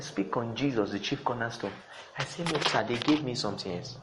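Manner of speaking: very soft, low retold exchange; hushed inner dialogue. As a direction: murmur the quoted exchange with the Lord.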